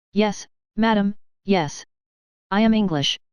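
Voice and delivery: put-on slow, halting English with a foreign accent; strong foreign accent. A narrator's reading. Carton answers Madame Defarge in a put-on foreign accent. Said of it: "in his former strong foreign accent".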